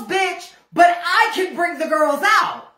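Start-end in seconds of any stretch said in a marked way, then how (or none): none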